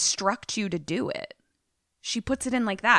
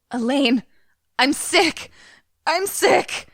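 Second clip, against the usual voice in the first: high, hammed-up pleading; mock crying; 7 semitones higher, 4 dB louder, slower than usual. Man begging lover from bed, high mock tearful plea. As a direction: imitate a man whimpering loudly for his lover. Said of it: "He starts crying and is like".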